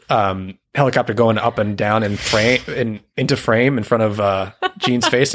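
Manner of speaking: ordinary talk, nothing marked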